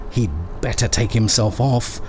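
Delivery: ordinary delivery, nothing marked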